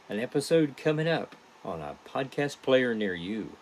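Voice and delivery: ordinary talk, nothing marked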